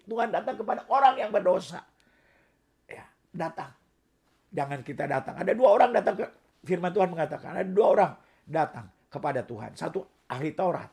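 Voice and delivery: normal talking voice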